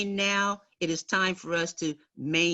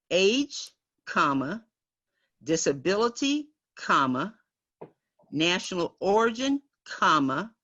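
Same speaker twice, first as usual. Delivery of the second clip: dictating. Shown as a demonstration. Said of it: "because I want it clear".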